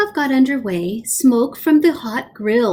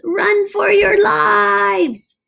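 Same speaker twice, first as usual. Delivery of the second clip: high, slow squeaky shout; tiny mice screaming as they run; panicked shout; 12 semitones higher, 4 dB louder, much slower than usual. A narrator's reading. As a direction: scream it as you flee. Soldiers flee monster.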